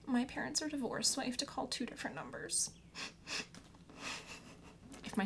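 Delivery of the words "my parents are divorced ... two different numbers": overly dramatic, mournful announcement; milk it like a tragedy. Pretend. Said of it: "I like to be really dramatic and say".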